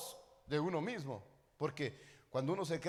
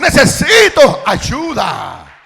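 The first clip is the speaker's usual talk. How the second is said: full-force preaching; 7 semitones higher, 24 dB louder than usual. Real, not acted. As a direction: cry it out to the congregation at full volume. (prédica ferviente que sube hasta el grito)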